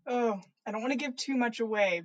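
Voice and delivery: plain reading voice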